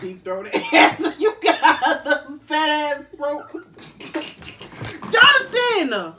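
slow loud outraged yelling; loud laughing yell. Real, not acted. Shouting after being soaked.

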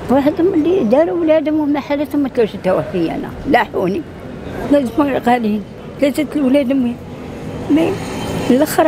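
high, tearful angry complaint; angry crying. Real, not acted. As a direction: cry out the grievance. Old mother complaining of children.

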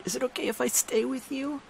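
soft, high, hushed question; whisper. A narrator's reading. Girl leaning down whispering to hurting narrator, soft. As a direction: ask gently under the breath. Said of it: "Missy leaned down and whispered".

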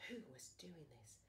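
soft whispered question; suspense whisper. A narrator's reading. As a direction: whisper the mystery to the child. Mystery question in story.

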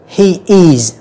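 HE IS as two separate full words; careful "he is", each word clear; full form. Shown as a demonstration. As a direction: say "he is" in full, uncontracted. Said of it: "chúng ta đọc đầy đủ".

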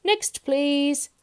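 loud, high call; booming dismissal; roaring milkman. A narrator's reading. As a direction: roar for the next customer. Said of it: "roared the milkman".

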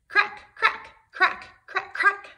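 high, whispered crack sounds; cracking eggs. Acted. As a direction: crackle out the eggshells breaking. Hatching eggs sound effect.

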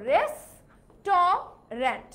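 very slow রেস্টুরেন্ট as res... to... rent; three separate pieces in রেস্টুরেন্ট, middle "to"; alternative mispronunciation. Shown as a demonstration. Teacher tests a reading from the spelling, রেস্টুরেন্ট as res-TO-rent. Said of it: "নাকি রেস্টুরেন্ট হবে".

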